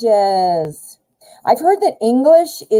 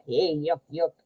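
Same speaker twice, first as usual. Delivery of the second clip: mock country twang, kept low and soft; country accent; 5 semitones lower, 9 dB quieter than usual. Shown as a demonstration. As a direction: slip into a hokey country accent. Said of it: "like, country sounding".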